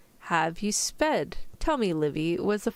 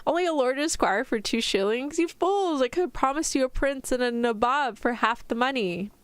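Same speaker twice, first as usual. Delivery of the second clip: lively, higher-pitched storybook father; indignant exclamation; 6 semitones higher, 3 dB louder than usual. A narrator's reading. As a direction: read it as theatrical disbelief at a bad bargain. Father's mock outrage in story read aloud.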